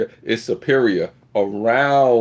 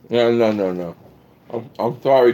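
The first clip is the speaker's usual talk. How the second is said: ordinary talk, nothing marked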